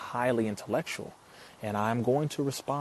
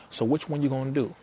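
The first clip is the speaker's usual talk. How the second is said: usual speaking voice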